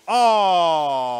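very loud, long shout of reaction; huge drawn-out "oh". Real, not acted. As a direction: belt out a sustained "oh". Reacting to a big hit.

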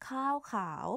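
ข้าวขาว with falling tone on ข้าว then rising tone on ขาว; Thai tones. Shown as a demonstration. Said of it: "One is, like, higher than another". (ข้าว falling tone, ขาว rising tone)

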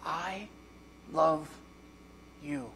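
slow, hushed and tender, word by word. Acted. Preacher voices Jesus telling the congregation, tender and hushed. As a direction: let each word sink in softly. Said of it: "Do you know what he says?".

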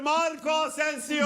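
high, slow drawn-out name call; screaming football commentator. Real, not acted. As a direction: stretch the scorer's name. Goal call, name stretched.

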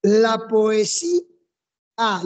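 slow "poesía", breaking off the final a after a pause; syllables pronounced apart. Shown as a demonstration. Teacher showing there is no diphthong, "poesía" split slowly before the a. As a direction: stretch to "poesí", pause, then a separate "a". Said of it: "ya está separado".

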